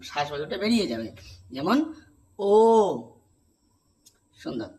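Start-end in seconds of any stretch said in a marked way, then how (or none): 2.4-2.5 "ও" with the lips rounded and the breath flowing out; rounded o sound. Shown as a demonstration. Teacher to class, modelling rounded "ও". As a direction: round the mouth and say "ও". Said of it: "মুখটা ... গোল হয়ে".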